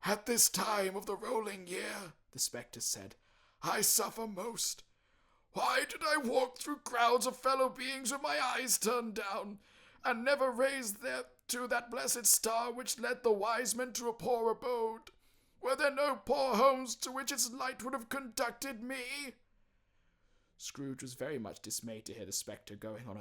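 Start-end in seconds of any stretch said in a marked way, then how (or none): 0.0-2.1 high, loud mournful spectre; anguished ghost lament, slowing at the end. Acted. Ghost voice in story. 3.6-19.3 high, loud mournful spectre; anguished ghost lament, slowing at the end. Acted. Ghost voice in story.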